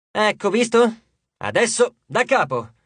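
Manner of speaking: high, brisk director's call; yelled order. A narrator's reading. Sammy yells into his dunce cap, directing the retake. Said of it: "urlò Sammy nel suo berretto".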